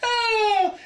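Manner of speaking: high, bursting giggle at the joke; delighted high-pitched laugh. Real, not acted. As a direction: laugh freely at the punchline. Laughing at own pecan joke.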